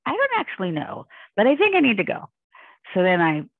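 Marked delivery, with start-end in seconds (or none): 0.1-2.3 retold in a much higher voice; high-pitched evasive reply. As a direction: pitch jumps up to dodge the question. Retells her evasive answer.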